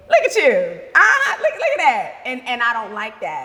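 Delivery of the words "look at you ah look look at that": loud, high taunting laugh; mock someone for showing feelings; high, mocking "look at you" with a laugh; mocking tease.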